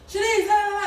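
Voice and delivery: loud, high mock scream over the fan. Women screaming at her over the bathroom fan, high and loud. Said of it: "women be screaming at me".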